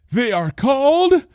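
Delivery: high, slow then dropping on the last word; hammed-up ominous pause; dramatic reveal. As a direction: build suspense and drop the reveal.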